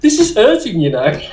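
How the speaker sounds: high-pitched mock protest, dropping at the end; pained complaint. Soldier re-enacting his protest to the medic, pained complaint. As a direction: complain through acted pain, then drop back down.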